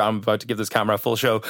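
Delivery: ordinary delivery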